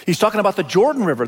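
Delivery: ordinary delivery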